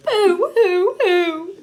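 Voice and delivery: high, loud hammed-up boo-hoo sobs; exaggerated puppet crying; mock wailing. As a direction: blubber like a cartoon crybaby. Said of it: "wailed Grover".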